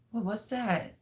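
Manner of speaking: high, startled question; startled cry. Retold or imitated. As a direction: blurt it out in a fright.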